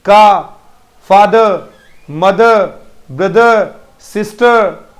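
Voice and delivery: loud, separate words, फादर ending "fa-duh" without र; silent final R. Shown as a demonstration. Teacher drills pupils on words, final R silent. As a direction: punch out मदर, सिस्टर with the final र dropped to "uh". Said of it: "English में कभी नहीं बोला जाएगा".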